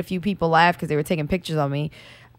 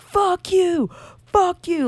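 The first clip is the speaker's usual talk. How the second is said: retold screaming; 8 semitones higher, much slower than usual. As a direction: imitate heckling at a held-back volume. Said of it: "screaming and yelling".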